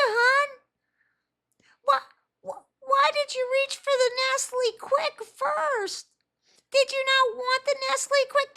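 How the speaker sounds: put-on very high, sweet baby-talk voice; mother cooing sweetly at her tantruming kid, very high; sugary high voice. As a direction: coo at the kid like a doting mom. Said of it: "it would be that voice".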